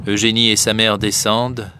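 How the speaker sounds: ordinary delivery, nothing marked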